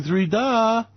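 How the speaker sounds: ordinary talk, nothing marked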